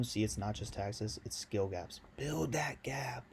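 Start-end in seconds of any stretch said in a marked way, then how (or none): 2.2-3.2 drawn out slow. Shown as a demonstration. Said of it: "I know you've heard that".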